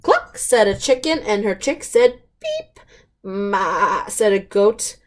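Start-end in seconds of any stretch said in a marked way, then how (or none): 0.0-0.4 a very high, chirpy cluck; high hen cluck. Acted. Chicken clucks in the story. 2.4-2.8 a soft, very high chick's beep; tiny high beep. Acted. Chick copies the horn. 3.2-4.1 a wavering goat bleat. Acted.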